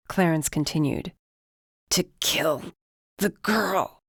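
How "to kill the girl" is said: gagging. A narrator's reading. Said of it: "Dresden gagged harshly".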